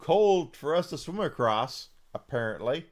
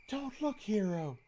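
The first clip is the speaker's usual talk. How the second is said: bashful Lucia voice; 8 semitones higher than usual. Pretend. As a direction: ask him not to look, embarrassed. Voicing Lucia in game scene.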